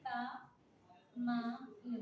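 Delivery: का-मा-ल drawn out very slowly, each syllable long. Shown as a demonstration. Teacher sounds out the word for the class, very slow. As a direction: stretch every vowel of का-मा-ल. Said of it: "कामाल कैसे लिखेंगे".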